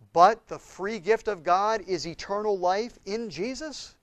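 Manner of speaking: ordinary delivery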